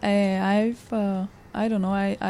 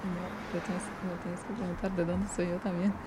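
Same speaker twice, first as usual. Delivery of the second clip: quiet aside; 10 dB quieter, faster than usual. Real, not acted. Side whisper to partner, out of role.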